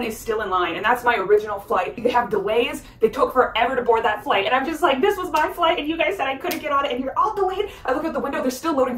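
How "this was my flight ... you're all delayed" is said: high-pitched incredulous complaint; indignant protest. Retold or imitated. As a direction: accuse them, voice pitched well up. Retold outrage at still-boarding flight.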